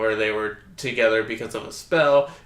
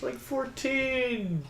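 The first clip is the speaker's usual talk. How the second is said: incredulous protest; 11 semitones higher, 9 dB quieter, much slower than usual. Real, not acted. Mock protest about Dawn's age.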